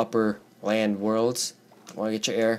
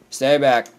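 raised-voice warning; 3 semitones higher, 6 dB louder than usual. Real, not acted. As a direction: warn it away, louder. Warding off an attacker.

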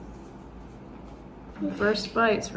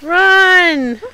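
loud call to run; sudden loud call; shout; 6 semitones higher, 9 dB louder than usual. Real, not acted. Mom urging kids to run.

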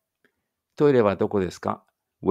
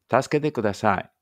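normal talking voice

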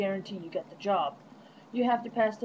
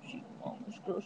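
cooing; 3 semitones lower, 9 dB quieter than usual. Real, not acted. Cooing to the dog.

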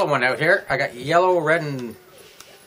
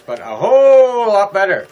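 bursts out louder in delight; excited; 4 semitones higher, 9 dB louder than usual. Real, not acted. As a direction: really sell how much better. Enthusing about snack chips.

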